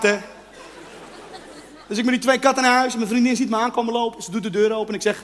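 ordinary talk

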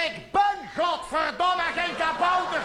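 angry gnome shout. Retold or imitated. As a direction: bellow it as a gnome who hates the word. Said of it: "zei hij". (woedend geschreeuwd, hoog en langzaam)

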